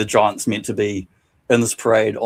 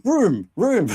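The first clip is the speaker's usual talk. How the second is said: high, ringing call of the word room; shouting; 11 semitones higher, 3 dB louder than usual. Retold or imitated. Lost wrestler yelling for his room in a retirement home, high shout. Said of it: "walked into the old folks' home shouting".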